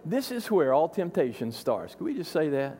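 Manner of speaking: ordinary talk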